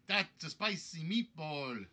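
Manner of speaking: slow, hammed-up Italian accent; drawn-out Italian-accent caricature; stagey Italian accent on a famous catchphrase. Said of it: "my Italian accent kind of fell apart there".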